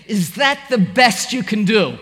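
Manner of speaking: disdainful sneer. Retold or imitated. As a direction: sneer it up at the man with the belt. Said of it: "and with disdain I say".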